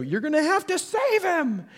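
high-pitched urgent holler; strained high shout, acted; guide calling out. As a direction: imitate a guide crying out for a rescue. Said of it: "I hear the guide go".

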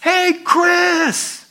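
high, loud holler down the line; calling out. Acted. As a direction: shout to someone far back. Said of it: "He goes".

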